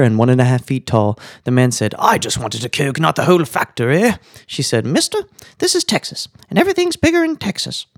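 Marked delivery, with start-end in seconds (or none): 2.0-4.1 lightly voiced put-out customer; mild mock protest, lifting at the end; complaining tourist. 4.9-7.9 very high-pitched waitress voice; squeaky-high joke character explaining to a customer; Texan waitress. Acted. Texan waitress answers the tourist.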